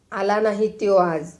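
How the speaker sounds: plain reading voice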